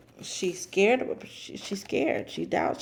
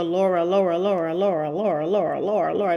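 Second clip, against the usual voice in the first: louder, insistent repeated call of a name; calling on the radio; 5 dB louder, faster than usual. Retold or imitated. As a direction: keep calling her over and over, nagging. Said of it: "she's been calling her on the radio".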